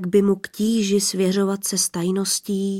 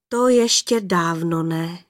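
ordinary delivery, nothing marked

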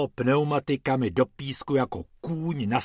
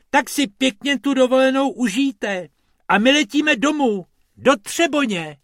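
high, loud rabbit goodbye; high rabbit wishing tourists a nice holiday; cheerful rabbit farewell; 11 semitones higher, 6 dB louder than usual. Acted. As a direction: wave them off in a high rabbit voice.

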